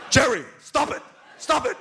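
very high, loud mock yell; exasperated yell. Director scolding the star for laughing, high exasperated yell. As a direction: imitate a director losing patience, voice jumping way up. Director yelling at giggling actor retold.

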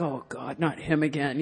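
low, quiet grumble; hushed, resigned complaint; weary Neanderthal dread. Acted. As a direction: drop the voice in private exasperation. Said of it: "I'm sure the Neanderthals were like".

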